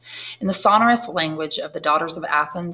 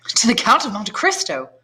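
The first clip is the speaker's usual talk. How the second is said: raised, eager exclamation; glad cry; 6 semitones higher, 3 dB louder than usual. A narrator's reading. Maximilien greets the count's arrival with an eager cry. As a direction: call it out with delighted surprise. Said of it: "cried Maximilien".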